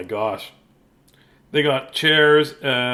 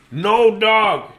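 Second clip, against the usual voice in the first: loud, higher mock-angry father; yelled no dog; 7 semitones higher, 6 dB louder than usual. A narrator's reading. Dad refuses Matthew, hammed-up yell. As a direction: bark it like a fed-up dad. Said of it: "yelled his dad".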